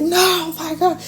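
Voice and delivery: high, soft despairing sigh of words; a lightly acted wail of regret; mock dismay. Client voiced regretting.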